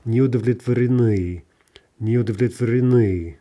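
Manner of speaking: удовлетворены stressed on its final ы; end stress. Shown as a demonstration. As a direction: get through all of удовлетворены and hit the last vowel. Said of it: "before we hit that stress at the end".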